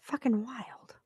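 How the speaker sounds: breathy whisper under her breath; whispered aside. Real, not acted. Muttering to herself on her live stream, whispered. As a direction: whisper it to yourself in disbelief. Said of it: "muttered aside".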